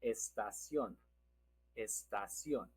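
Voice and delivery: "estación" softly, stress on the ción; soft "estación", accent on the last syllable; stress on final syllable. Shown as a demonstration. Teacher shows the accent, quietly stressing end of "estación". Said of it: "Again, we have the accent".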